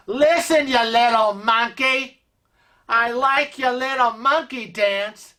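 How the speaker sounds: scolding Maine local, high and sharp; New England accent. Retold or imitated. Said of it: "She goes up to the crew member and she says".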